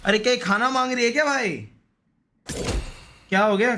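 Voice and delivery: ordinary talk, nothing marked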